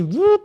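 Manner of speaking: quick rising "vup" sound effect; imitated mechanism noise. Podcast guest mimics speakers lifting up, mechanical whoosh. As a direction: imitate a hidden compartment popping up. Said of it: "fez assim".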